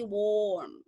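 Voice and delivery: "warm" with the aw vowel held long. Shown as a demonstration. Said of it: "dia harus panjang". ("warm" dengan O panjang)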